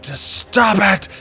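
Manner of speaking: loud, slow drawn-out yell. Retold or imitated. Knight yelling at his confused friend. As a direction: bellow it slowly at him. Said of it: "Just yelling at him".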